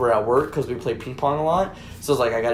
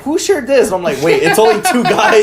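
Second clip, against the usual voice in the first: surprised; 8 semitones higher, 6 dB louder than usual. Retold or imitated. Retold own surprised reaction.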